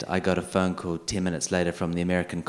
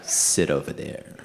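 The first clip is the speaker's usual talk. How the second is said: low, slow, curt order from a clerk; curt officer; 5 semitones lower, slower than usual. Retold or imitated. Consul officer waving him to a seat, low and curt. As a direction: drop it low and deadpan like a bored official.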